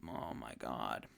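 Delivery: exasperated. Real, not acted. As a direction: let it sink under the weight of it. Exasperated at the awful inference.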